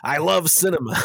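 high, loud, gushing Scorsese voice; Martin Scorsese impression. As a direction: gush about cinema like Scorsese. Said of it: "my Marty Scorsese impression".

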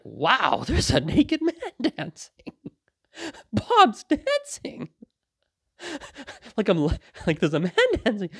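high, helpless laughter breaking into the words; laughing. Retold or imitated. Telling of seeing a naked man dancing, laughing. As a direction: laugh through it till the words squeak. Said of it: "And I kinda just laughed".

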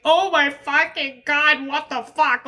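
high, drawn-out stunned wail; overwhelmed disbelief. Retold or imitated. Retelling her reaction to glowing feedback, overwhelmed disbelief. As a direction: stretch it out high, mind blown.

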